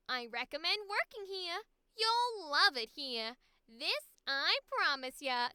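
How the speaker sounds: high, loud, beaming with a nasal New York edge; bubbly cheerful character voice. Acted. Arlene welcoming a new hire, bright and loud. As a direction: sell the workplace like a sugary greeter. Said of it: "Why do I do that voice".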